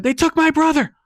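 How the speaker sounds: high, loud, desperate cry; panicked cry. Acted. Kylie telling Andy her brother was taken, high and frantic. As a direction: cry it out in fear. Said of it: "Kylie is screaming".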